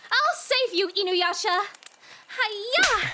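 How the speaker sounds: high, loud mock-heroic shout; heroic battle cry. Girl swats a spider off Inuyasha, loud heroic shout. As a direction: charge in with a karate yell.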